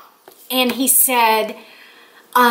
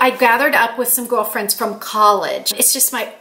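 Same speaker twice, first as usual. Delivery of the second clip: ordinary talk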